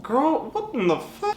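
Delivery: high, slow, stretched-out shock; shocked disbelief. Retold or imitated. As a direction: squeal it in total disbelief. Retold shock meeting old friend.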